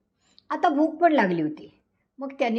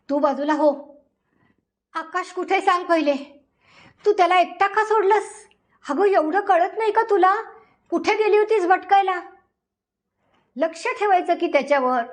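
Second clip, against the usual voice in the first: frantic scolding; 6 semitones higher than usual. Acted. Panicked husband scolds wife.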